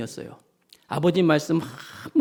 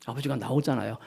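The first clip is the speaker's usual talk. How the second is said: ordinary talk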